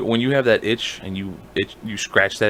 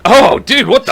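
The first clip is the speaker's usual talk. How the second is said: disgusted shock; 12 semitones higher, 8 dB louder than usual. Retold or imitated. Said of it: "you're like".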